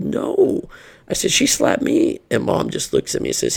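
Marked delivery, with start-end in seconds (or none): 0.0-0.7 high-pitched, indignant "no"; retold protesting no. Retold defiant reply to dad. 1.3-2.2 high, strained protest; retold indignant protest. Retold protest against mom's slap.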